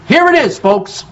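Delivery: ordinary talk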